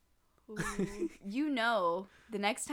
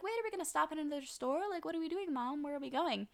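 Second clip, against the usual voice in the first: put-on higher-pitched voice of a puzzled kid; higher, quick retold questions to mom; questioning child; 7 semitones higher, faster than usual. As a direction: lightly imitate a child quizzing mom. Voicing confused child questioning her mother.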